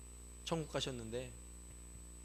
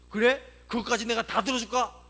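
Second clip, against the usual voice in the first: loud, high, threatening question; challenging divine retort; 6 semitones higher, 8 dB louder than usual. Retold or imitated. God hears every grumble, challenging retort. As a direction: throw the complaint back sharply as God.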